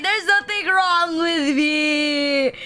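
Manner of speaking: imitated crying. Boy crying to the class, imitated tearful protest. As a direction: act out the boy's tearful denial. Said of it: "he cried and he was like".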